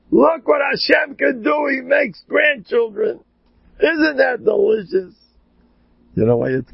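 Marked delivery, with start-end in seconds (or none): 0.1-5.1 joyful shout. Retold or imitated. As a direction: shout it out with delight. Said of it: "he was screaming".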